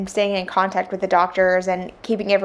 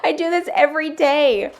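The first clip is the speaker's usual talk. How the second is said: higher, louder mock outrage at him; exasperated retort; 8 semitones higher, 3 dB louder than usual. Wife retorting to her husband, high indignant mock outrage. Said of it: "I'm like".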